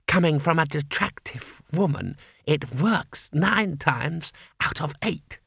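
slyly flattering door knocker. A narrator's reading.

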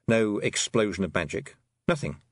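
usual speaking voice

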